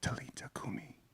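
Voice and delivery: put-on soft, hushed whisper to the child; tender whisper. Jesus leaning over the dead girl, whispering tenderly. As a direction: breathe it gently over her. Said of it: "Jesus leans over to her and he says".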